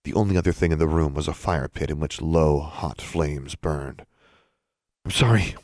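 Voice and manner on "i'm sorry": breathless. A narrator's reading. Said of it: "Alexandra said breathlessly".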